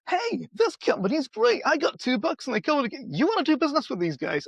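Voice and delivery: a happy customer raving to a friend, hammed-up; delighted customer. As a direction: gush about the company like a thrilled buyer.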